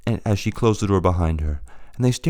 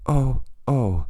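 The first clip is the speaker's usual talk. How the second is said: soft crying; 4 semitones higher than usual. A narrator's reading. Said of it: "he cried softly".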